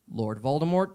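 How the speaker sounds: ordinary delivery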